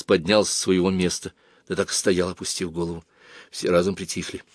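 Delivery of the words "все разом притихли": ordinary delivery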